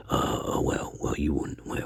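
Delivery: ordinary delivery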